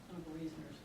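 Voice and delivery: ordinary delivery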